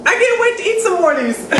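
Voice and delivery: fast, laughing through the words. Real, not acted. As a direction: giggle as you say it. Laughing while gushing about chips.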